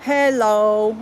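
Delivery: drawn-out, held dog-style hello; imitating talking dog. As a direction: lightly mimic a dog saying words. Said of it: "she'll say".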